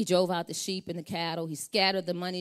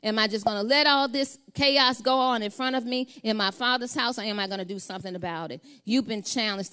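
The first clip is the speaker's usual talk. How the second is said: higher, louder preaching that keeps building; full-force preaching; 7 semitones higher, 7 dB louder than usual. Acted. Preacher urging the congregation not to surrender, preaching hard. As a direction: drive the point home from the pulpit.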